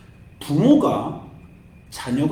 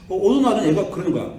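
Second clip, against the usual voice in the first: normal talking voice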